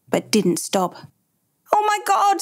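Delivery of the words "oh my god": high-pitched cry of horror, contained volume; narrator's restrained scream, pitched way up; panicked scream. A narrator's reading. Mother sees her car taken with children inside, screaming. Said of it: "Sean screamed".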